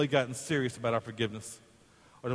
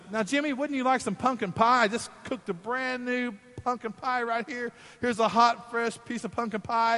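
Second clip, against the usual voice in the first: sweet grandma; 9 semitones higher than usual. Retold or imitated. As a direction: coax him like a doting grandma. Grandma offers pie.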